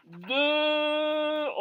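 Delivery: long, held rounded "De"; drawn-out demo vowel. Shown as a demonstration. Teacher modelling the vowel of dessous, drawn out. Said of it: "on dit dessous".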